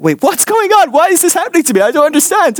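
mock panic. Young man under countdown, high mock panic. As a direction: squeal it like you're cornered.

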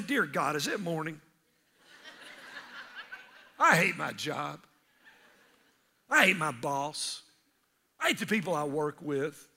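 low, tired, dragging groan; weary complaint. Retold or imitated. Preacher mimics a tired worker, low weary groan. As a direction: moan about the job. Groggy believer at home.

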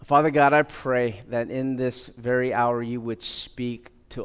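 low, measured praying voice; prayer. Real, not acted. Pastor leading the congregation in prayer before his message, low earnest prayer. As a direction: pray earnestly, low and unhurried.

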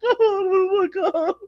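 high, slow, whiny wail; high drawn-out mock cry; mock despair. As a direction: wail like you're trapped with him. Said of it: "this is me in my car".

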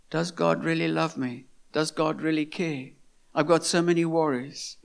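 soft, low anxious questioning; quiet worried doubt. Retold or imitated. Preacher voicing a worrier's doubts, softly. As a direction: murmur the doubts like a lost believer.

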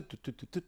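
soft, short imitated keypad tones; dialling beeps. Caller taps in the actress's number, soft mouth-made dialling tones. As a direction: beep out the number quietly. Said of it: "Den trækker jeg over".

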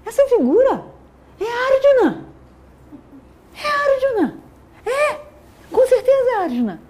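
high, loud cry of recognition; exclaiming out loud. Acted. Drona recognizing Arjuna on the battlefield, excited and loud. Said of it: "Drona tá falando alto".

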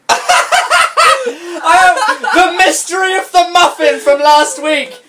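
high, loud, drawn-out proclamation through laughter; mock-dramatic outburst. Reader laughing at the muffin mystery, loud hammed-up cry. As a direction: declaim it like a ridiculous big reveal.

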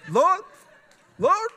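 high, loud cry of Lord; mock ecstatic cry. Pastor imitating Sharonda caught up in the Spirit, high ecstatic cry. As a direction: cry out like someone overcome by the Spirit.

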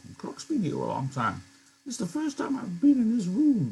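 put-on high old-man voice for Candy, a bit embarrassed. Old Candy, embarrassed, to Crooks, high old-man voice.

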